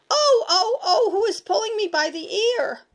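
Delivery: shrill high yelping scream; boy cries out as his ear is pulled, screaming; screamed in a strange voice. A narrator's reading. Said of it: "screamed in a voice unlike my own".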